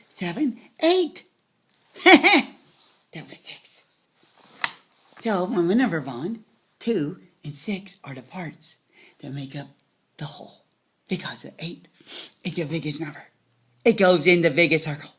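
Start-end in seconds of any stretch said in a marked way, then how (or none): 3.1-10.6 whisper. Acted. Whispered math recap. 11.1-13.3 whisper. Acted. Whispered math recap.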